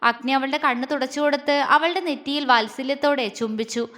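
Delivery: usual speaking voice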